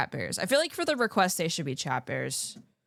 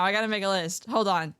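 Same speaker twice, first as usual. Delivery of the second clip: ordinary delivery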